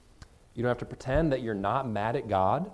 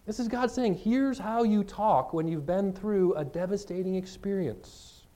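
pitched up, slow and earnest; raised urgent reply; 6 semitones higher than usual. Pretend. Pastor coaching the hurting to answer critics, pitched up and slow. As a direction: lift the voice and press it slowly.

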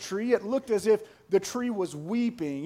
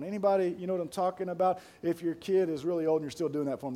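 ordinary delivery

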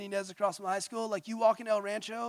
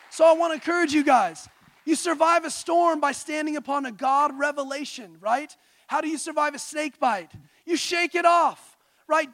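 loud, higher preaching call; rousing sermon delivery, louder and higher; 6 semitones higher, 10 dB louder than usual. Acted. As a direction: preach it out to the room. Preaching at full force.

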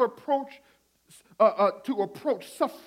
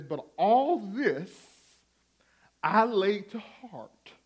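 slow, solemn Bible reading; scripture reading; 3 semitones lower, 3 dB quieter than usual. Acted. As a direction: read each phrase slowly, reverently.

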